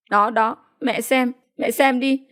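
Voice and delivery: lightly voiced weak, plaintive woman; feeble complaint. A narrator's reading. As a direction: appeal weakly to mother, pitch rising. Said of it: "Bác Tư yếu đuối bảo". (giọng yếu đuối mách mẹ)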